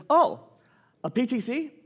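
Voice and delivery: high, bright-eyed acted enthusiasm; eager reply. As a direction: play the boss perking up at the question. Boss eagerly explains in retold dialogue.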